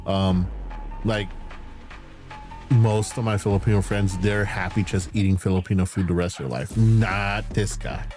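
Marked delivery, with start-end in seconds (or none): none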